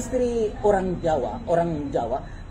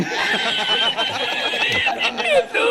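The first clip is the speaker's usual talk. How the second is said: shrieking high devilish laugh; ghostly high cackle; 4 dB louder than usual. Acted. The ghost husband mocks his widow with a shrieking high laugh.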